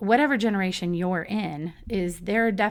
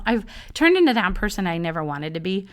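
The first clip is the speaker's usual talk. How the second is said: ordinary delivery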